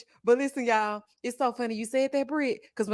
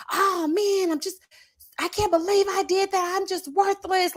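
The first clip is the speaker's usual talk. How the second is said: imitating an upset son, pitched up; higher voice, beating himself up; self-critical son; 9 semitones higher than usual. Parent imitating son who got something wrong, higher-pitched dejection. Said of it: "my son is very hard on himself when he gets something wrong".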